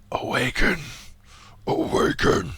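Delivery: breathy whispered call. Acted. A hidden voice whispering to the sleeping commander. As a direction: whisper it like a distant summons.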